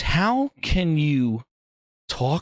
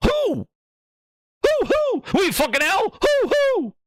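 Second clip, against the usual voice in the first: very high yelled who; mock-bewildered shouting. Real, not acted. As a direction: shout it like nobody knows them.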